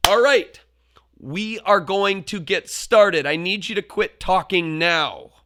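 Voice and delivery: forceful shout, slow and heavy on "are"; as loud and firm as you can. Retold or imitated. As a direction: bark at the class with a growl. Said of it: "as loud and as forceful as I could".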